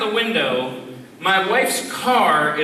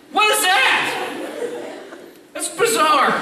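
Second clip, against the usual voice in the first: baffled outcry; 11 semitones higher than usual. Acted. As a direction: exclaim at an inexplicable mystery. Baffled by phantom voice calling.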